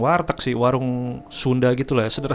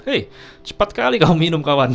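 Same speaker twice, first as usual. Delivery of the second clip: put-on higher, louder Batak-accented surprise; startled exclamation; 7 semitones higher, 5 dB louder than usual. Seller amazed at how fast friend drank, startled. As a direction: blurt it out in mock astonishment. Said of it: "Si abang itu kaget".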